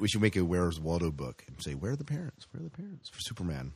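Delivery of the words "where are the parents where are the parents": high, quiet sing-song mock question. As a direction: tease it like a kids' puzzle book. Mock Where's Waldo book line.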